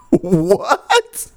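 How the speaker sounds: high-pitched, incredulous squawk; baffled disbelief. Retold or imitated. Musician backstage answers the German officer, baffled high-pitched disbelief. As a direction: imitate being utterly thrown.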